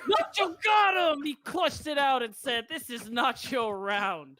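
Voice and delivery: loud, high, slowed hype call; esports caster. Real, not acted. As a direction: roar it out like a caster. Co-caster shouts the clutch.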